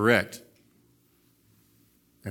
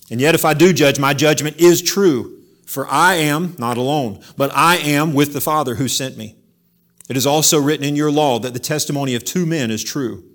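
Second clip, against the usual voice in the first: louder, preaching the words at full force; forceful scripture reading; 3 semitones higher, 7 dB louder than usual. Acted. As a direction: proclaim the verses with force.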